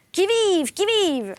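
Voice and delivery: loud, high sentry-like challenge; calling out. A narrator's reading. Man groping towards the family in the dark, shouted challenge. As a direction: shout it out high, stretching each call. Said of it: "en criant".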